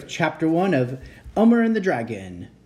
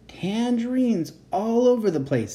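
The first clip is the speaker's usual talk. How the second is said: put-on boy voice; 9 semitones higher than usual. Elmer voiced in read story.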